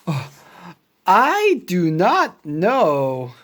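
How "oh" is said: ordinary delivery, nothing marked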